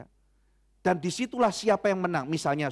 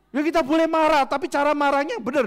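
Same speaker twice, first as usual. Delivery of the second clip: much higher and louder, forceful; emphatic preaching; 9 semitones higher, 7 dB louder than usual. Real, not acted. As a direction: press the congregation hard. (khotbah tegas, jauh lebih tinggi dan keras)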